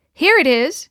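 high, loud cry of triumph; triumphant. A narrator's reading. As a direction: announce it like a victorious find. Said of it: "she said triumphantly".

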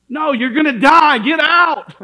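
loud, high urgent yell; shouting to someone in a burning house; screaming warning. Retold or imitated. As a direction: scream at them to get out. Repeated fire warning, louder.

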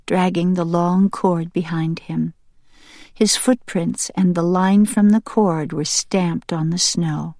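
ordinary delivery, nothing marked

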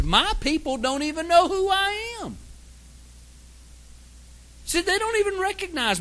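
high, pained voice of a grieved God; God's lament. Acted. Preacher voicing God, grieved that his people don't know him. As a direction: cry out as a hurt father whose people forgot him.

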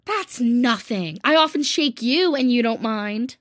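calm him down gently; soothing. A narrator's reading. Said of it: "said Jo soothingly".

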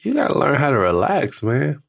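a hint of the kids nudging dad to relax; voicing his sons. Sons telling their intense dad to loosen up, lightly voiced. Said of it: "And they said".

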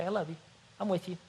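usual speaking voice

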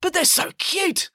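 high, slow, pleading protest; plaintive dismay. Acted. Hades dismayed for baby ducks.

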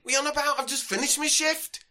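hammed-up sozzled Yorkshire lad; drunk Yorkshireman. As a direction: drunk Yorkshire festival-goer demanding a wristband. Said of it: "determined slasher from Yorkshire".

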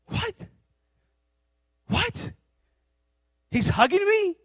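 high, incredulous question; astonished boy. Acted. Son expecting punishment gets hugged by dad, astonished. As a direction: squeak in mock disbelief, pitch shooting up. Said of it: "all of a sudden".

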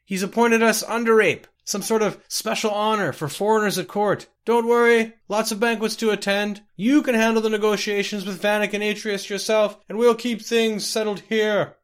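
loud, high calling over distance; yelling from afar. Acted. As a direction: yell back to the departing carriage. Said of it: "yelled Laides Eurus".

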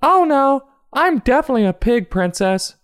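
very high squeaky denial; Princess Pig. Acted. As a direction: protest quickly in a tiny high voice. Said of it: "says Princess Pig".

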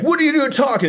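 very high-pitched mock scolding; mock screaming boss. Boss catching kitchen workers chatting, mock-angry. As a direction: imitate an angry boss riding the staff. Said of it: "screaming at them all the time".

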